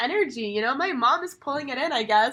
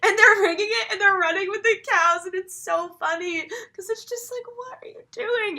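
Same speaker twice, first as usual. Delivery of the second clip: excited laughing retell; 9 semitones higher, 4 dB louder than usual. Real, not acted. Excitedly retelling funny scene.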